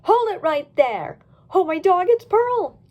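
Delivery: loud, high commanding shout. Acted. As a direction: stop them with a yell.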